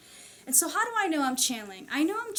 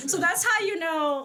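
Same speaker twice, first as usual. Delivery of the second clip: laughing; 8 semitones higher, 6 dB louder than usual. Real, not acted. Amused at her own 'tis example, laughing through the words. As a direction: still laughing, higher and louder.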